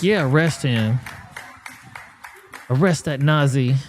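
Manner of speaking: mock cheering crowd. Host imitating a crowd cheering an arrest, mock cheering. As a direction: lightly voice an egging-on crowd. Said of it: "they're cheering over there".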